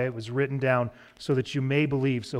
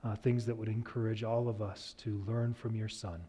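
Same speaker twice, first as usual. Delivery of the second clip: prayer; 4 semitones lower, 9 dB quieter than usual. Real, not acted. Closing prayer, hushed.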